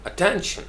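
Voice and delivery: Attention high, with "ten" lengthened; high a-TEN-shn stress on attention; long TEN. Shown as a demonstration. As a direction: lift and stretch TEN in attention. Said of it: "टेन जो है उसको लंबा करना है".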